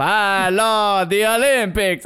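a hint of old Dracula; drawn-out mock vampire hello; mock Count Dracula. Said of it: "Old Count Dracula himself".

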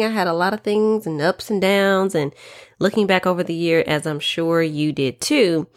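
ordinary talk

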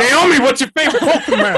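high, loud parody through laughter; laughing mock question. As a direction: squeal the question while laughing. Mock repeat of host's question.

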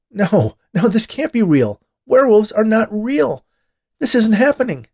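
high, loud disbelief; frantic, near-hysterical shouting; panicked denial. A narrator's reading. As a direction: cry out, refusing to believe it. Man panicking as he transforms.

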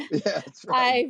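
laughing out loud, high and loud. Real, not acted. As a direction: burst out laughing through the words. Host laughs at guest's joke.

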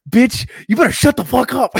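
high, loud outburst; exasperated shouted retort; outraged comeback. Retold or imitated. Imagined comeback to the venue owner.

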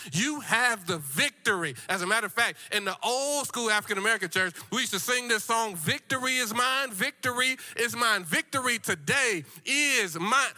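rousing church preacher in full flow; full-force preaching. Real, not acted. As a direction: preach victory to the congregation with punched key words.

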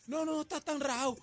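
voz aguda que imita a la esposa; quítale importancia en voz alta de tono, imitándola; esposa tranquilizadora. Retold or imitated. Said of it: "me mira con la cara y me dice". (imitación aguda de esposa restando importancia)

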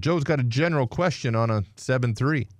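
upbeat show announcer; radio host voice. Acted. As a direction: welcome listeners back. Host returns from break.

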